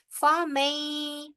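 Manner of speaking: फमई with mim running straight into ya; slow chanted word; silent nun. Shown as a demonstration. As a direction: let the mim glide into ya in फमई. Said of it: "नून साकिन जो है वो silent".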